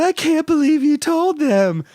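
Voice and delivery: very high, slow mock embarrassed squeal; hammed-up flustered whine; fake blushing. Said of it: "fake blushing".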